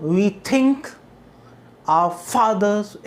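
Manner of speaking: "We" light, "think" stretched and stressed; measured poetic beat, drawing out "fathers" after a light "our"; light-heavy rhythm. Shown as a demonstration. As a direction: recite slowly, leaning on "think" and "fathers", with "our" kept light. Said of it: "को तो आप हल्का बोलो".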